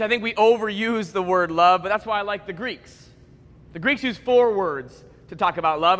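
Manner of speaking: usual speaking voice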